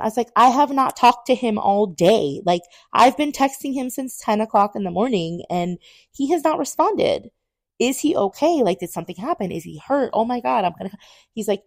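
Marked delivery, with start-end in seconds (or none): 0.3-10.9 high-pitched panicked retelling; frantic worry. Retold or imitated. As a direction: act out rising alarm, pleading for answers. Retold frantic worry about boyfriend.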